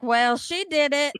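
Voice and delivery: ordinary talk, nothing marked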